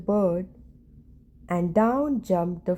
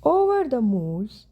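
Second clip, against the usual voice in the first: ordinary delivery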